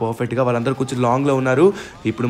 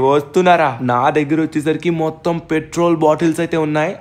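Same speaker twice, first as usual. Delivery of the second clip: usual speaking voice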